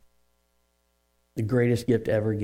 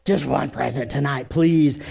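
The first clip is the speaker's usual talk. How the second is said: put-on mildly wheedling kid's plea, putting on a show; a touch of theatrical begging; pleading child, retold; 3 semitones higher than usual. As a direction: imitate a daughter begging, lightly hammed up. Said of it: "she was putting on the show".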